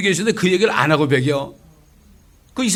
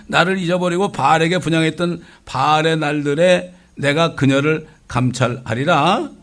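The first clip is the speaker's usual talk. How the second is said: loud, measured reading of the prophet; solemn scripture reading; 2 semitones higher, 4 dB louder than usual. Acted. Preacher reading Hosea to the congregation, solemn and loud. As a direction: read God's word with weight.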